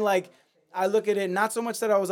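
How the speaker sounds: normal talking voice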